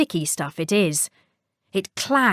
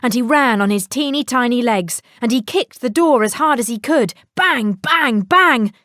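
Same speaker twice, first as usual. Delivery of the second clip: ordinary delivery, nothing marked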